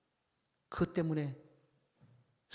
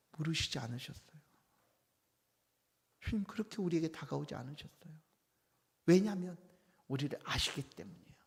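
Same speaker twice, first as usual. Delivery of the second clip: normal talking voice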